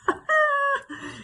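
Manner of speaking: high, hammed-up titter; high comic laugh. Gossip delighted by her own retort, high comic laugh. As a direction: laugh shrilly at your own joke.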